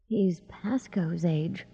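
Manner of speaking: slow whisper of dawning horror; horrified whisper. A narrator's reading. Sandry to herself on seeing the boy's age, horrified whisper. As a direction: whisper it slowly, as a horrified thought. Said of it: "thought Sandry in horror".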